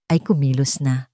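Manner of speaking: usual speaking voice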